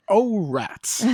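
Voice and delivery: high, bright punchline delivery; punny quip. Shown as a demonstration. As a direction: toss off the pun. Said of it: "What you shoulda done".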